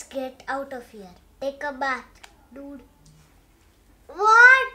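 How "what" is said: high, loud shocked what; outraged what. Acted. Pigeon told to take a bath, loud shocked outburst. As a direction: explode in disbelief.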